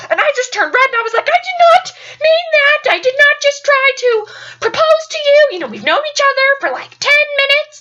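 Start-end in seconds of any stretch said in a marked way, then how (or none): 1.3-7.8 high, loud, frantic protest; insisting hard, mortified; flustered denial. Retold or imitated. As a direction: backpedal in embarrassed panic. Mortified denial of accidental proposal.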